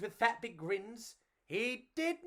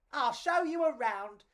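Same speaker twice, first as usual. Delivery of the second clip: higher, bubbly rat voice; friendly rat; 6 semitones higher than usual. Acted. Ruby the rat offering to show them the city. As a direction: offer the tour cheerily. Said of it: "said Ruby Rat".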